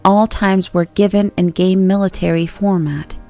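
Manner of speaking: ordinary delivery, nothing marked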